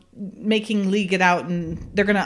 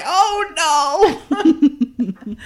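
mock dismay; 6 semitones higher, 5 dB louder than usual. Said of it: "She's like".

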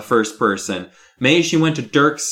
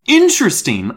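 high, loud outraged echo; shrieking; 8 semitones higher, 6 dB louder than usual. A narrator's reading. Gary outraged at Miss Carmen's word, shrieking. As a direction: shriek it back in disbelief. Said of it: "I shrieked".

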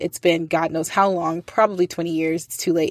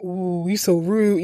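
slow drawn-out mocking ooh; mimicking classmates egging on a fight; teasing ooh; slower than usual. Classmates tease the student for being rude, slow mocking chorus.

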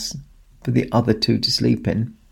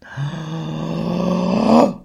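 gasp; 6 semitones higher than usual. A narrator's reading. Reader reacts to threat.

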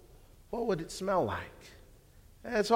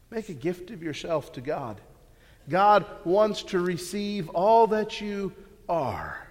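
ordinary delivery

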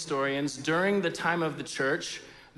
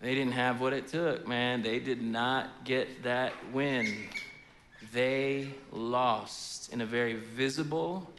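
pitying murmur; 6 semitones lower, 6 dB quieter, slower than usual. Retold or imitated. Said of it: "we go".

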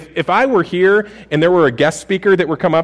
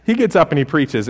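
usual speaking voice

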